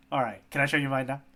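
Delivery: ordinary delivery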